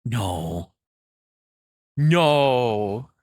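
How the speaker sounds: ordinary talk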